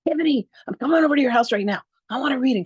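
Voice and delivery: retold excitement, fast and high-pitched; excited. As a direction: rush it out, bursting to get there. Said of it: "I was so excited".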